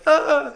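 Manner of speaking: mock scream. Imagining being charged.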